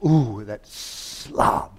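long, drawn-out scornful mutter to oneself; slow mock disgust; indignant afterthought. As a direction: stretch it out with relish, scornfully. Mimics their own indignant afterthought.

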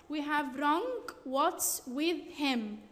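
ordinary talk, nothing marked

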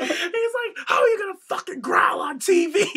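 high-pitched words squeezed out through laughter; real helpless laughter over a shouted quote; laughing while talking. Real, not acted. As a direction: try to finish the quote while cracking up. Retelling story, laughing hard.

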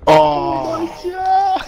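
laughing shout. Real, not acted. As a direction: laugh it out loud and slow. Przyjaciele śmieją się z pomyłki.

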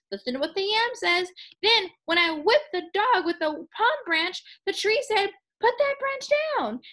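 high-pitched, agitated retelling; panicked farmer. A narrator's reading. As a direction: blurt it out to a stranger in alarm. Frightened farmer blurts out his tale.

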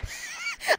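high, light giggle; quick high-pitched laugh. Real, not acted. Laughs about the drone.

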